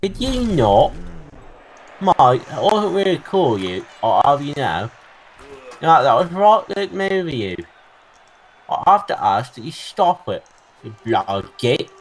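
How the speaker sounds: hammed-up Cockney, pitched high and loud; indignant mock-Cockney telling-off; Cockney accent. Player scolding an opponent over a move, in mock Cockney. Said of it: "What did I get my Cockney accent out for?".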